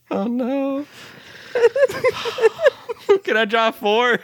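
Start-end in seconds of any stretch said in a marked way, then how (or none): 1.5-3.2 embarrassed laugh. Real, not acted. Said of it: "embarrassed laughter at SpongeBob card".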